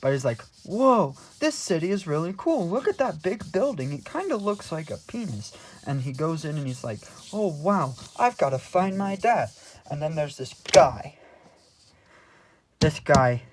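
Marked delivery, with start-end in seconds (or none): 0.6-5.5 high-pitched naive amazement; hammed-up awestruck newcomer; mock wonder. Buddy marvels at the city. 7.3-9.5 high-pitched eager elf; lightly hammed-up amazement; mock wonder. Buddy marvels, retold.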